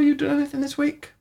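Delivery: ordinary talk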